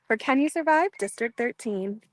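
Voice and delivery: ordinary talk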